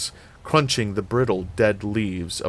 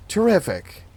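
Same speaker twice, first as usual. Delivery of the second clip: exasperated sarcasm; 11 semitones higher than usual. A narrator's reading. Sarcasm at a missing light switch.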